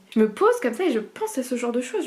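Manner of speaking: ordinary talk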